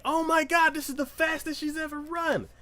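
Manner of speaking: high, excited mock crowd cry; amazed crowd. Crowd watching her sprint ahead, amazed. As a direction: squeal in disbelief like an onlooker.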